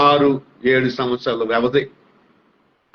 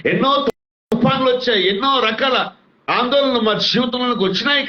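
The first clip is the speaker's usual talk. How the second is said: rising preaching fervour; 6 semitones higher than usual. Real, not acted. Pastor urging his listeners on prayer, rising impassioned preaching. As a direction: pitch climbing higher line by line, impassioned.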